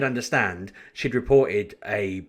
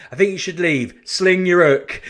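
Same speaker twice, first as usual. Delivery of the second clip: louder, higher, triumphant dismissal; sending her off; 8 semitones higher, 7 dB louder than usual. Retold or imitated. Telling rude customer to leave, louder triumphant dismissal. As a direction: show her the door with relish. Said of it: "always wanted to say".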